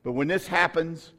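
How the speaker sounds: preaching with rising force. Acted. Preacher exhorting congregation, rising sermon delivery. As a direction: urge the church on with fire.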